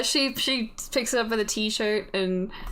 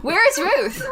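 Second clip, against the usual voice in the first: raised, higher-pitched demand; urgent demand; 11 semitones higher, 6 dB louder than usual. Acted. Officer demanding to know where the missing girl is, urgent and loud. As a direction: cut in loudly, pitch jumping up.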